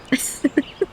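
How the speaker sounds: light airy laugh rising; laughter leaking out; breathy high giggle. Real, not acted. Still laughing at the name.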